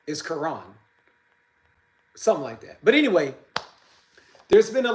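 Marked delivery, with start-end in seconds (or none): 0.2-0.6 "Karan" as kuh-RAHN, stress on the end; "Karan" with a weak first syllable, then RAHN; believed native pronunciation. Shown as a demonstration. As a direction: say "Karan" with a long "ah" in the last syllable. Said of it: "the pronunciation of Karen's name".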